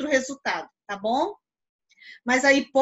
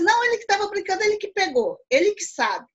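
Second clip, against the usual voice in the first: acted pupil insisting, higher pitch; assertive accusation; 7 semitones higher, faster than usual. Pupil accuses classmate over missing phone, assertive insistence. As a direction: pin it on a classmate with certainty. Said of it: "uma afirmação pela sua entonação de voz".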